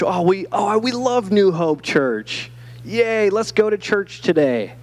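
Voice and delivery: playful pretend excitement, stretching the words; mock enthusiasm. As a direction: imitate a keen church member cheering. Mimics keen churchgoers cheering.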